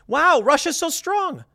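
high, drawn-out wide-eyed mock awe; mock impressed voice. Host mocking what Russia supposedly says, high mock awe.

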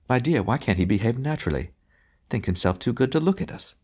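a genteel snob tutting; prissy sniffing. A narrator's reading. Prissy voices sniff at driver.

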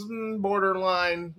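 usual speaking voice

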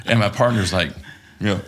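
normal talking voice

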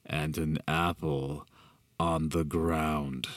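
slow explaining. Acted. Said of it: "slowly started lowering the fist".

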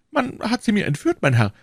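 high, plaintive shopkeeper voice; anxious prisoner. Acted. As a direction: plead your case, pitched high. Anxious prisoner answers the commissioner.

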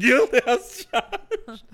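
slow, high, camp echo; drawn-out high repeat. Real, not acted. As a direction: stretch it out high and campy.

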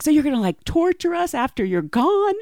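imitating a shocked sister; incredulous sister. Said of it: "and they're like".

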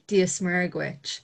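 "Dia Smurg Ghuit" slow, dee-a smerg gwitch; Irish reply. Shown as a demonstration. Irish guest teaching the reply greeting, slow careful Irish. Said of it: "and you would say".

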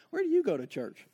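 curious friend. Acted. Acted chat with a curious friend.

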